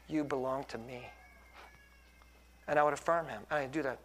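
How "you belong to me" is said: low, soft affirmation to a son; gentle firmness. Retold or imitated. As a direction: say it quietly, tender but sure. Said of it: "with a gentleness and a firmness".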